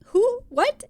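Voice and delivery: high, loud confused yelp; sudden squeaky bewilderment; baffled. Retold or imitated. Asked if she's John from New Jersey, high baffled yelp.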